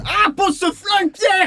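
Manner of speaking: high, slowed, desperate cry with a yell on the name; panicked plea. Acted. Pleading with Pierre holding a gun, panicked shout. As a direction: beg him to drop the gun. Acted sketch, threatened with gun.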